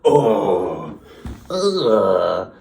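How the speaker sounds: loud, drawn-out "oh" and "ugh"; loud impressed groan. Real, not acted. Reacting to a fire bar, loud impressed groan. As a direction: groan out loud at a great line.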